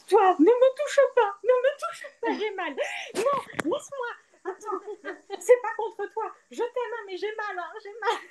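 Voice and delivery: high, pained voice pushing him away; a woman in labour begging him not to touch her; pained plea. Retold or imitated. A woman in labour to her husband, high and pained. Retelling yelling at husband in pain.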